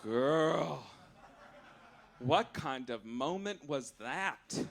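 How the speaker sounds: usual speaking voice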